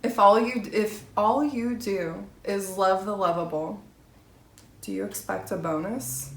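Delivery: normal talking voice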